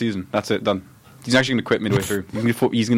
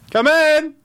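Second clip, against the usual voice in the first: very high, loud sing-out; mock menacing visitor's loud call; calling through door; 5 dB louder than usual. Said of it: "you'll just hear".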